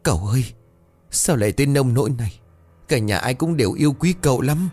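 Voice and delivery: mournful maid. A narrator's reading. Maid mourns young master.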